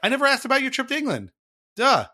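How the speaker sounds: high and loud, fast, kicking himself; self-mocking excitement. Real, not acted. Host teases himself for forgetting guest's trip, fast and loud. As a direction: rush it out, laughing at your own slip.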